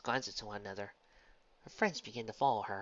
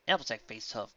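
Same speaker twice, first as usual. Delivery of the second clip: ordinary delivery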